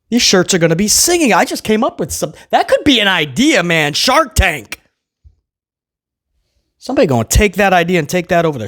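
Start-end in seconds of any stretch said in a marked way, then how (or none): none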